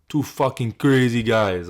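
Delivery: slow, lightly put-on Czech accent on "Two fucking crazy guys"; imitating Yuri. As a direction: drag out "crazy" like Yuri in broken English. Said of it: "he did this post-fight speech".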